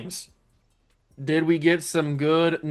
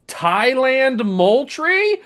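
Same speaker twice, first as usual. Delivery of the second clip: loud, drawn-out high disbelief; real amazement, slow and rising; incredulous name reading; 10 semitones higher, 6 dB louder, much slower than usual. Real, not acted. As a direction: stretch the name out, high and loud, as a stunned question. Reacting to an absurd draft name.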